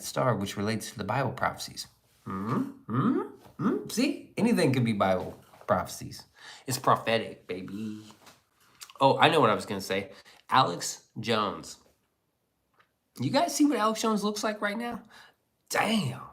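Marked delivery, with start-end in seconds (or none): 2.3-4.3 mock squeaky hmm. 13.2-16.2 amazed. Real, not acted. Awed at Alex Jones photo.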